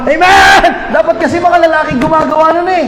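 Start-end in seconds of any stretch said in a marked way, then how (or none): 0.0-0.9 high, hollered mock amen; shouted amen. Preacher imitating someone standing with a Bible, high shouted amen. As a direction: yell it out like an over-eager worshipper. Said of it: "pangit kapag ikaw yung sisigaw".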